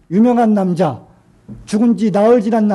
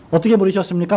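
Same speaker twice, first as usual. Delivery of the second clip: usual speaking voice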